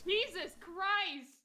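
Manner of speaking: high, loud slow gasp of shock; stunned exclamation. Real, not acted. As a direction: blurt it out shocked. Big roll result reaction.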